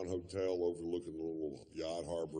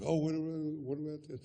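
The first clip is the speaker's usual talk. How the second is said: fan voice; 11 semitones higher than usual. Retold or imitated. Fans pestering Doc in public.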